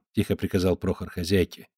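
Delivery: plain reading voice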